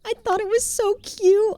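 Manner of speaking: high cooing gush. Real, not acted. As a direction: squeal over something cute. Gushing over a cute item.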